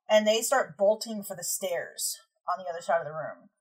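usual speaking voice

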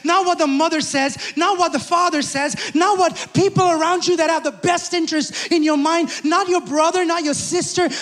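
loud, high, driving preacher's cadence; fiery preacher calling out the congregation; full-force preaching. Acted. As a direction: preach it hard, building the list.

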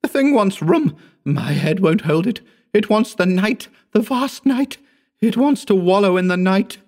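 high, frantic delirium; tormented, very high-pitched outcry; screamed raving. A narrator's reading. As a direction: rave in a desperate shriek. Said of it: "he screamed".

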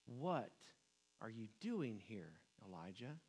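hushed, tender call from a gentle whisper. A narrator's reading. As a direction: let God's question fall to a near whisper. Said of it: "came a gentle whisper".

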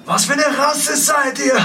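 loud, slow shout. Acted. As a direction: call it out loud. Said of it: "Du schreist".

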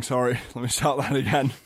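usual speaking voice

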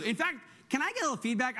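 ordinary delivery

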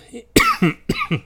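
harsh cough mid-sentence; rough cough from the chest; chesty cough. Real, not acted. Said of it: "excuse me".

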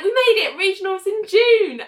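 high, triumphant declaration; excited announcement. Retold or imitated. Elaine telling Richard they qualified, excited announcement. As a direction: announce the good news with excitement.

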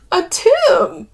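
high, loud tearful answer; through thick tears. A narrator's reading. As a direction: force it out through crying. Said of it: "she says through thick tears".

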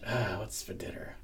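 soft, breathless whisper; whispered question. Retold or imitated. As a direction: murmur the question under your breath. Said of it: "I'd be like".

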